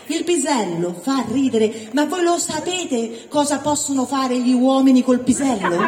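ordinary delivery

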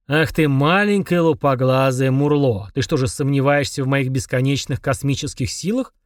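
usual speaking voice

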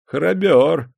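high, loud mocking sneer. A narrator's reading. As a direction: sneer at his bravery. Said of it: "усмехнувшись, сказал Никита".

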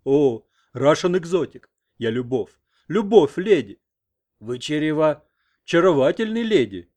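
put-on loud, halting American-accented Russian; American attempting Russian. A narrator's reading. American courts his nurse in broken Russian, American accent. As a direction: struggle through Russian with an American accent, exclaiming. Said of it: "попробовал сказать на русском".

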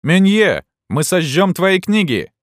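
high, loud taunt with a drawn-out threat; threatening shouts. A narrator's reading. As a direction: yell at the bookseller to scare him. Students shout threats at bookseller.

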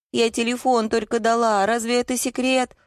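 high-pitched plaintive wail; tearful protest. A narrator's reading. Wife defending herself to angry husband, tearful. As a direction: plead innocence in a high, tearful voice. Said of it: "заплакала Зоя".

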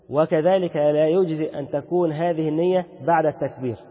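normal talking voice

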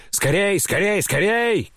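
high-pitched urgent order; commanding. A narrator's reading. Grandmother orders her bearers to hurry, high and commanding. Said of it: "командовала бабушка".

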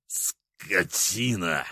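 slow, drawn-out hiss; hissed insult. Acted. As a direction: spit the word out slowly. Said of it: "прошипел он".

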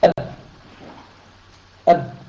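clipped أب with the sound cut off sharply on the ب; أب said as a short, stopped syllable; stopped letter demo. Shown as a demonstration. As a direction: close the lips and stop the air dead on أب. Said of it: "أن تحبس جريان النفس لما تقول".

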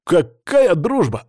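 loud, high, drawn-out disbelief; indignant protest. A narrator's reading. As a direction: object in outraged disbelief. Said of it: "опомнился вдруг Филипп".

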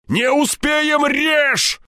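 high, desperate yelled order; frantic shout. A narrator's reading. Степаныч fears the gunman escapes, shouting frantically. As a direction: yell it in rising panic. Frantic argument over cutting rope.

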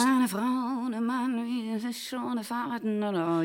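soft, slow, far-away murmur; absent dreamy. Acted. Wife answering absently, dreamy and far away. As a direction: drift off as if not quite there. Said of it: "du bist gar nicht mehr ganz da".

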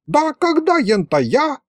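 high, squeaky protest; indignant old wizard. A narrator's reading. The wizard denies it to grandpa, squealing high. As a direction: squeal up in mock hurt.

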